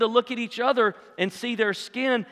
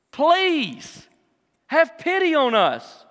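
high, slightly raised plea; calling out; 5 semitones higher, much slower than usual. Acted. Lepers calling out to Jesus for pity, calling out. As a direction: plead out to someone at a distance. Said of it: "calling out to Jesus in a loud voice".

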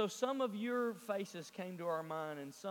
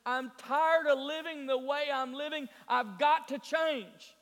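usual speaking voice

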